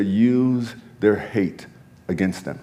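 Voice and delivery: slow, measured inner voice; voice of Holy Spirit. Retold or imitated. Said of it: "I hear the Holy Spirit say".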